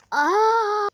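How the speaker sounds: sudden high loud yelp; shocked yelp. Real, not acted. (ani yüksek çığlık)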